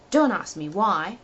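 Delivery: plain reading voice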